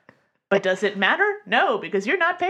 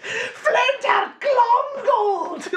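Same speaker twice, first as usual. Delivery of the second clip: high, very drawn-out cartoon Scottish bellow; bad Scottish accent with no subtlety at all; over-the-top Scottish accent; 4 dB louder, much slower than usual. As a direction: proclaim it as a hammed-up Scottish cartoon villain. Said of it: "my go-to Scottish accent".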